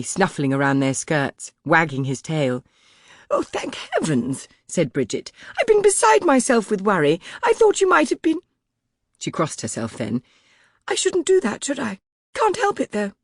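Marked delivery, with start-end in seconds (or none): 3.3-4.5 much higher, fretting maid, relieved; a flustered servant's high voice; anxious maid. A narrator's reading. Maid Bridget's lines, relieved. 5.6-8.4 much higher, fretting maid, relieved; a flustered servant's high voice; anxious maid. A narrator's reading. Maid Bridget's lines. 10.9-13.1 much higher, fretting maid, relieved; a flustered servant's high voice; anxious maid. A narrator's reading. Maid Bridget's lines.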